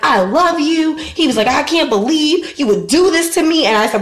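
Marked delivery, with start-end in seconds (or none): 0.0-1.0 higher, lightly whiny lovesick imitation; mock pleading ex. Voices her pleading, lovesick ex. 1.5-3.7 higher, lightly whiny lovesick imitation; mock pleading ex. Voices her pleading, lovesick ex.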